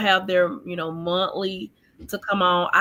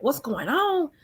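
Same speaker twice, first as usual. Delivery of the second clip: mildly imitating an upset mother, higher pitch; mock demanding questions, pitched up; retold going off; 6 semitones higher than usual. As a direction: raise the pitch, retelling her indignant questioning. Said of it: "like, going off".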